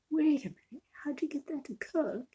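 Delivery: high, incredulous questioning; puzzled viewer. Retold or imitated. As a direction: voice the doubtful viewer. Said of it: "you're probably wondering".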